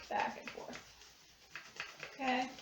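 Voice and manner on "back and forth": soft whisper while concentrating on rubbing; whispered aside. Shown as a demonstration. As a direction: breathe the words out quietly.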